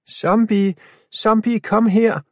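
high loud calling to the zombies; luring shout. A narrator's reading. Girl luring the zombies away, shouting. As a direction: lure them away with a yell. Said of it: "Afia løber lidt og vifter med armene".

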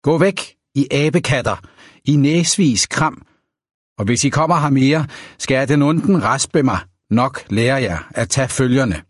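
ordinary delivery

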